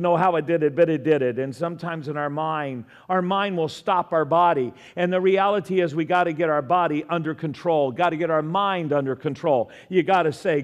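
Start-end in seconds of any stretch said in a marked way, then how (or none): none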